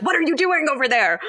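high-pitched accusing question; mock bickering. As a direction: nag him like a bickering spouse. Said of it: "first they're bickering".

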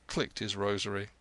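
plain reading voice

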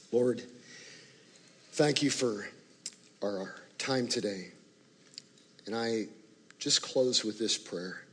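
closing prayer. Acted. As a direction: pray gently over the room.

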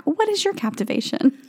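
ordinary delivery

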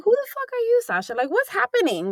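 half-laughing mock outrage at herself; retold self-scolding. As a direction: scold yourself in disbelief. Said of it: "And I was like".

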